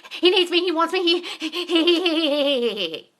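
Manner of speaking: high, loud, laughing through it; mock the desperate girl, giggling; laughing needy parody. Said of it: "That's a different energy than".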